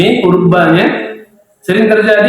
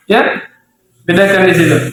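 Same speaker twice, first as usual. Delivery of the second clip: usual speaking voice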